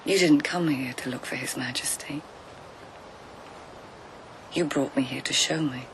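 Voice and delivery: quietly see through him; soft realisation. Acted. Narrator gently realising why the guide brought them, hushed.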